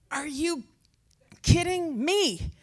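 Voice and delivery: high, drawn-out mock outburst with a pause midway; retold exasperated outburst. Woman snaps at her houseguest after weeks, exasperated mock outburst. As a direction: act out finally snapping, pitch shooting up. Retells finally snapping at housemate.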